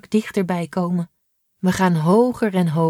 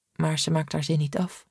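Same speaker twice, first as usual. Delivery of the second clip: usual speaking voice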